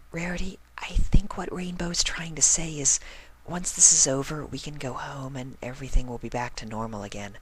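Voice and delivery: high, soft near-whisper; timid high whispery voice; meek. A narrator's reading. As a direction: shyly say it, barely audible. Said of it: "Fluttershy told her meekly".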